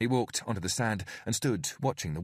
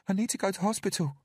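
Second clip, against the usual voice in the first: put-on high, small frightened child; timid little girl pleading; scared little girl; 13 semitones higher, faster than usual. A narrator's reading. Narrator voices Bella.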